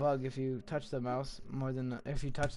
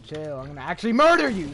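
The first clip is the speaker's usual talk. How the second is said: mock threat; 3 semitones higher, 10 dB louder than usual. Real, not acted. Friend touching keyboard, threatening.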